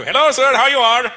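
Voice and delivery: loud, high Indian shopkeeper greeting; hammed-up Indian accent. Shopkeeper greets a customer, hammed-up Indian accent. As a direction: lay the Indian accent on thick. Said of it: "we really ham it up too".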